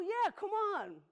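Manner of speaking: drawn-out happy welcome for the pig; cheerful welcome. Retold or imitated.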